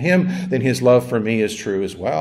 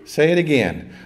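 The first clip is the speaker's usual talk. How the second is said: ordinary delivery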